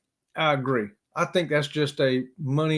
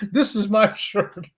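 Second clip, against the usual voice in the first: higher and slow, relishing each word; amused slow repeat; 5 semitones higher, slower than usual. Retold or imitated. Jack Reacher's line quoted back to viewers, amused and slow. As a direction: savour "This is my shirt." like a favourite line. Savouring the movie line again.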